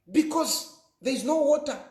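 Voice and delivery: ordinary talk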